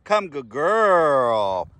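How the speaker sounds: normal talking voice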